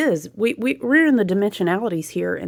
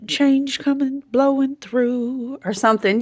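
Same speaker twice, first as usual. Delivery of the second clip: higher, slow, prim storybook nanny; lightly hammed-up Mary Poppins impression; 7 semitones higher, slower than usual. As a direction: drawl it out like a magical nanny. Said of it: "Mary Poppins?".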